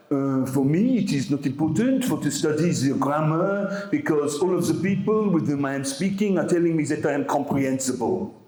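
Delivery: put-on a light French accent, quoting a student; mild French-accented English. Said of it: "basically by speaking French in English".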